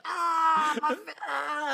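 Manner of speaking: high, loud mock scream of pain; imitated pain scream. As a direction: yelp like he's been hit in the face. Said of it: "starts screaming".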